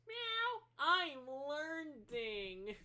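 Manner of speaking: high, slow meowing cat voice; stretched-out high cat meow. Acted. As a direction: drag it out like a cat learning. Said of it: "The cat goes".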